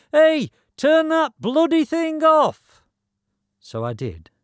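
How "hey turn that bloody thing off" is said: very high, loud, drawn-out yell; neighbour shouting. Acted. Missus Wilkins shouts from over the road, loud complaint. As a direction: holler it slowly across the road. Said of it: "Missus Wilkins from over the road shouts".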